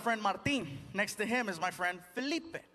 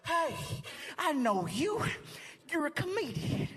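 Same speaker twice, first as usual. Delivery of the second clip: cop; 5 semitones higher, slower than usual. Retold or imitated. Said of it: "He's like".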